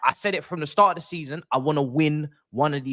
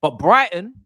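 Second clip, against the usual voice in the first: usual speaking voice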